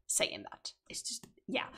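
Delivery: breathy whispered aside, barely audible. Real, not acted. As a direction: drop to a secret whisper. Whispered aside after saying it.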